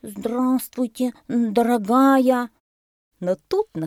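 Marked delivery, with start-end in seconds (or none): 0.0-2.5 high, slow, loud little elephant recital; reciting learned greeting. A narrator's reading. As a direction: proclaim the memorised words, stretched out. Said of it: "начал говорить слова, которые он выучил". (старательно декламирует, высоко и протяжно)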